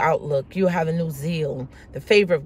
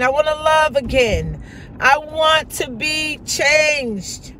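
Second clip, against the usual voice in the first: higher, louder pleading prayer; fervent prayer; 7 semitones higher, 3 dB louder than usual. Acted. Preacher leading a prayer of repentance, fervent plea.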